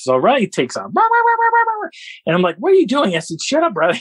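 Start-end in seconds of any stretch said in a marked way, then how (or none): 0.9-1.9 high, rapid yapping; imitated dog barking. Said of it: "Riley barks". 2.6-4.0 retold scolding. Retelling himself scolding the barking dog.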